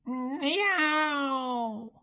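a high, breathy little cat meow; boy whispering a kitten mew; whispered meow. Acted.

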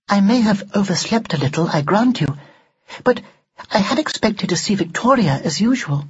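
high, formal doll voice; prim talking doll. Acted. Doll to Ned about missing Victoria, primly. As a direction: speak high and precisely, like an old-fashioned doll. Haughty old-fashioned doll figure.

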